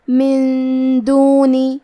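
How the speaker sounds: slow chant, nun of من hidden in a long hum before دونی; hidden nasal n. Shown as a demonstration. Teacher shows ikhfa, slow chanted من دونی with held hum. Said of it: "غنہ کے ساتھ ادا کریں گے".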